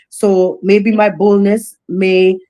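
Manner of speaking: normal talking voice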